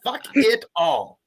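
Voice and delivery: hammed-up furious rant, shouted high; over-the-top angry fan ranting at the refs; angry reading. Shown as a demonstration. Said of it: "read it from an angry point of view".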